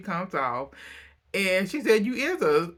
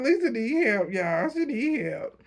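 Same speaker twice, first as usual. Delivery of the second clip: amused exasperation; 6 semitones higher than usual. Real, not acted. Amused verdict after laughing fit.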